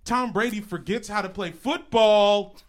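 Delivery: loud incredulous outburst, climbing to a yell; passionate, high-pitched outrage; fiery rant. Real, not acted. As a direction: build to a screamed last word. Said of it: "Fiery, passionate beginning to the show".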